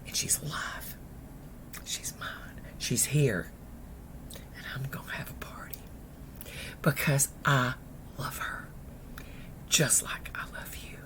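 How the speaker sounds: very soft, low, whispery with pauses; hushed, tender. Retold or imitated. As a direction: let each phrase sink almost to a whisper. Mama's tender climax.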